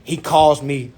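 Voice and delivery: ordinary delivery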